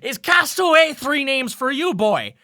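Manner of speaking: high, loud teasing sailor drawl; an old sea dog ribbing the newcomer; gruff old sailor. Acted. Sailor Elris teasing the crew about names, high and loud.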